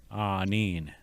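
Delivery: "Ah Nin" said as ah-neen; "Ah Nin" with open ah and a long neen; Ojibwe greeting. Shown as a demonstration. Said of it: "most common greetings you will hear".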